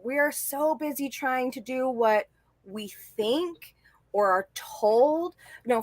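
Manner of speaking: usual speaking voice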